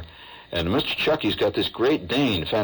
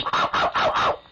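little dog yelps, unvoiced; breathy dog yapping; yapping corgi. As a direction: yip like a tiny corgi. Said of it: "The corgi goes".

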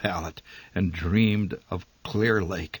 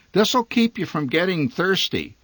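a higher, lightly voiced mother handing out wisdom; lightly voiced mother, higher and knowing; sage motherly advice; 8 semitones higher than usual. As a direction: counsel the kids like a wise mom. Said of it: "she counseled sagely".

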